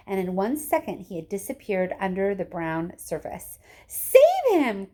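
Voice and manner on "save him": high, loud panicked scream; mother screaming as her son sinks. Acted. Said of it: "screamed Missus Gloop".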